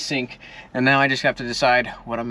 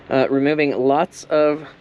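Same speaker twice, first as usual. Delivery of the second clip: usual speaking voice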